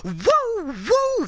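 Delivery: high, far-reaching falsetto woos; ringing Ric Flair style wooing. Retold or imitated. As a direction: let rip the Nature Boy's signature woo. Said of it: "and he just goes".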